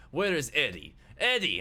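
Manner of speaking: loud demanding shout, rising on the name; forceful acted holler; yelling. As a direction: yell for someone who is hiding. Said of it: "starts yelling".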